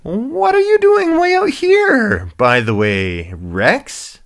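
high, loud, happy hippo question; Lucky. Acted. Happy hippo questioning lost rhino, high cheery voice. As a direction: start in a squeal and ease down. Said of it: "the hippopotamus".

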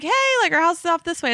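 high-pitched retold "hey" to get attention; retold calling out. Telling the swingers they took a wrong turn, calling out high.

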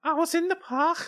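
very high-pitched Scouse impression; Scouse accent. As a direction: squeak it out in put-on Scouse. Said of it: "Everyone loving your Scouse accent there".

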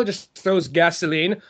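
ordinary talk, nothing marked

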